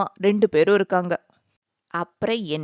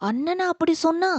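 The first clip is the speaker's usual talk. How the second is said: higher, stunned question; shocked; 8 semitones higher than usual. Acted. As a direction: sister can't believe her brother said it. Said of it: "கயல் அதிர்ந்து போனாள்". (அதிர்ச்சியுடன் கேள்வி)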